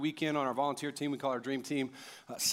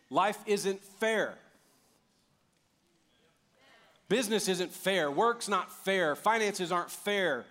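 emphatic preaching; 4 semitones higher, slower than usual. Acted. Preaching list.

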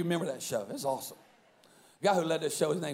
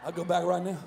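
usual speaking voice

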